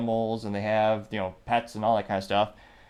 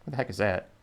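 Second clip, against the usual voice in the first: ordinary delivery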